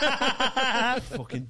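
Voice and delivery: slow, laughing through the words. Real, not acted. Laughing to friends about his awkward moment. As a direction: laugh at your own cringe.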